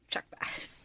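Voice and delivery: breathy whispered punchline; whispered aside. Real, not acted. Punchline aside about Ed Westwick.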